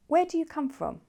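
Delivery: "Where" high, sliding down to "from"; stress on first part. Shown as a demonstration. Teacher recaps open questions, stressing "Where". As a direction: start high on "Where", fall away. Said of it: "We stress the first part".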